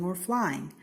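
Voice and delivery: ordinary delivery, nothing marked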